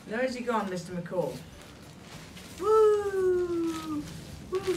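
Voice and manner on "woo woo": high loud woo; excited whoop. Real, not acted. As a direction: cheer the kite on. Kite soaring overhead.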